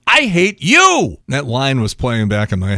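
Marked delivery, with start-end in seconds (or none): 0.0-1.2 high, slow, emphatic movie comeback; mock angry retort. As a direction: punch each word of an angry film line. Acts out movie hero's retort.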